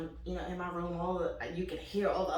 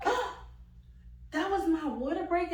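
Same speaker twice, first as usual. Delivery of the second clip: high, astonished gasp then question; mock surprised gasp; 10 semitones higher than usual. Young mother realizing water broke: high mock gasp. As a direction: act stunned at the news.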